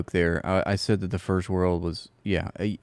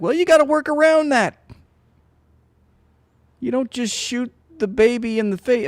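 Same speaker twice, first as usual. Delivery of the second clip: much higher, loud mock-exasperated voice; Bill Burr bit; 4 dB louder than usual. Retelling a comedian's bit on the shields excuse, loud high mockery. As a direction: scold like a fed-up comedian. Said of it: "what Bill Burr says".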